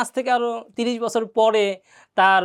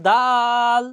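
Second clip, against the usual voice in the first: stretched demo; 3 dB louder, much slower than usual. Shown as a demonstration. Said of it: "এখানে একটা টান দিয়েছি".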